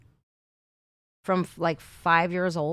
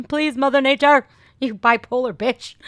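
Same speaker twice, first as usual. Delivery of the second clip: mock rant; 7 semitones higher, 10 dB louder than usual. Yelling at the weather.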